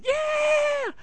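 very high sustained mock scream; piercing pitch, held back in volume; imitated scream. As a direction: squeal like a terrified kid on a coaster. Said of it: "The whole time just screaming".

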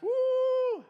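long high, loud "woo"; excited whoop. Retold or imitated. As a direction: whoop it up on a wild ride. Whoop while off-roading in story.

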